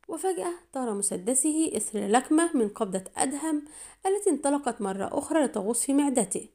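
ordinary delivery, nothing marked

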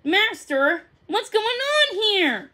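stunned by his master; shocked. A narrator's reading. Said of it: "Baxter looked shocked".

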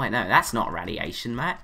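ordinary talk

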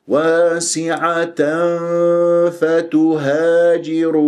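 slow chant, n of واسعة hummed into the f; ikhfa with ghunna. Shown as a demonstration. Teacher shows hidden n in واسعة فتهاجر, sustained chant. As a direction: hold the nasal before فتهاجر. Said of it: "أذهب بال-، باطن الشفة".

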